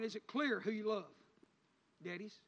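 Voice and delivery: ordinary delivery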